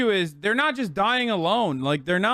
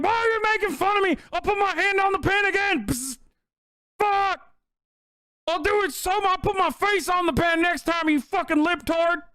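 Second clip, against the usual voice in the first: very high, squealing mock tantrum; hammed-up high-pitched indignant whine; whining bad kid; 11 semitones higher than usual. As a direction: caricature a defiant kid throwing a fit. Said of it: "that's what it sounds like".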